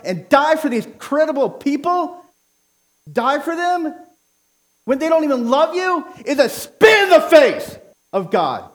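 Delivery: rising to a loud, high preacher's cry; impassioned sermon shout; preaching yell. Real, not acted. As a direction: let the conviction burst out loud and high. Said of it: "I'm sorry that I'm yelling".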